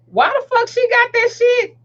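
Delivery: high-pitched mock outrage; judgmental disbelief. Onlooker judging a leashed little girl, incredulous. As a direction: question it high, like a scandalized onlooker.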